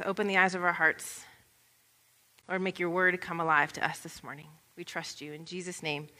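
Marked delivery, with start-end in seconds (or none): 2.5-6.1 soft, low closing prayer; hushed prayer. Real, not acted. Pastor closing prayer to the congregation, softly. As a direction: pray quietly to the Lord.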